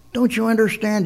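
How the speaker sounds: a hammed-up desperate cry; mock panic. A narrator's reading. First castaway begging the calm one to understand, mock panic.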